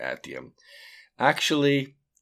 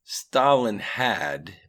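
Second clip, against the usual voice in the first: very slow, measured Stalin had; slow, deliberate analytical start; 4 semitones higher, 5 dB louder, much slower than usual. A narrator's reading. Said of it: "in his slow and analytical voice".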